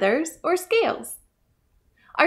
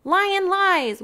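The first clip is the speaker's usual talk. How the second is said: higher, bold accusing call; mongoose cries out; 9 semitones higher, 4 dB louder than usual. A narrator's reading. Mongoose calling out Lion, bold and raised. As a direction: call out the liar fearlessly. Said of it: "Mongoose cried".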